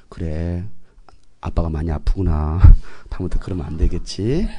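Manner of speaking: very low, overly patient tone; mock-gentle father. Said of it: "화낼 때는 화내는 거예요".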